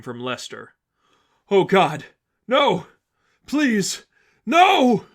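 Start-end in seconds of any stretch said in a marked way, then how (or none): 1.5-5.1 high, loud panicked screaming; terrified scream. Acted. Lester screaming through the headphones in terror, high and loud. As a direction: scream in terror, rising to the last no.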